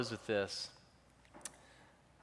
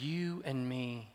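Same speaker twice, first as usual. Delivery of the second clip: tender promise; 3 dB quieter, much slower than usual. Retold or imitated. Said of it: "She says".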